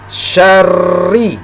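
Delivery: SYARRI with a long, pressed double r; doubled, pressed r. Shown as a demonstration. Teacher demonstrating tasdid to learners, pressed double r in syarri. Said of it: "ditekan ketika membacanya".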